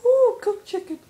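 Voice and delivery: very high, delighted squeal. Real, not acted. Gamer thrilled to find cooked chicken, high squeal.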